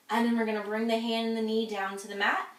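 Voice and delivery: ordinary talk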